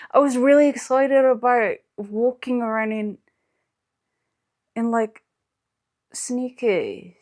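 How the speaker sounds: hammed-up wail of despair; dramatic whining. Melodramatic lament over small shoes.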